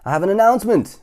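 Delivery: loud announcement. Acted. Miss Trouble addressing the crowd, loud announcement. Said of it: "announced Little Miss Trouble".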